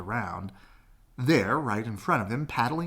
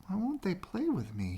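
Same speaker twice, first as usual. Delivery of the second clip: sad; 10 semitones higher, 5 dB quieter than usual. Acted. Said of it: "he wondered sadly".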